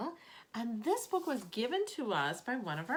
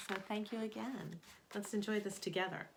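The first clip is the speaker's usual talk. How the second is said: ordinary delivery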